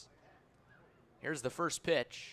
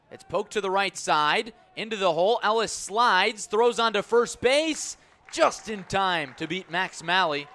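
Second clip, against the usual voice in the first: higher, louder call that climbs as the throw goes to first; excited play call; 4 semitones higher, 7 dB louder than usual. Real, not acted. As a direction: ride the play up to the bang-bang finish. Live call of close play.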